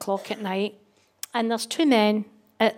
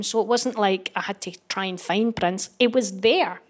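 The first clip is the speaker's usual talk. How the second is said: ordinary delivery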